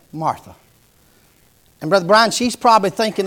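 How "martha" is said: soft, gentle Martha; soft first call. Acted. Lord calling Martha gently, a first soft call. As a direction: call her name softly, almost in passing. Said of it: "the first time he probably said".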